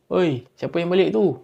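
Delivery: calling out. Retold or imitated. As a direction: call out to whoever came home. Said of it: "Dia panggil". (berseru memanggil)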